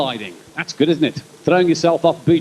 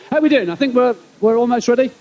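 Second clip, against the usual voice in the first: usual speaking voice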